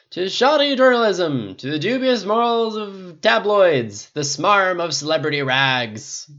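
slow, loud, high-pitched toast; drunken cry. A narrator's reading. Tony toasting the gutter press, drunken cry. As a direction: proclaim a bitter toast. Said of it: "Tony cried".

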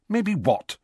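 high-pitched, impatient question; rather snappish. A narrator's reading. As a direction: snap back a little. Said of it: "said Hermione rather snappishly".

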